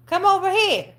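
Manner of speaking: high, loud coaxing call; calling the dog. Real, not acted. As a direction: coax the dog over, up high.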